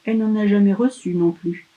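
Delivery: ordinary delivery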